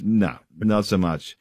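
ordinary talk, nothing marked

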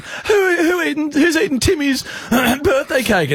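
very high, wailing interrogation; hammed-up distraught parent demanding the culprit; mock outraged parent. Parent questioning a lineup of kids, hammed-up outrage.

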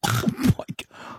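slow stunned whisper; awed whisper. Retold or imitated. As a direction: breathe it out in disbelief. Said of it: "We go".

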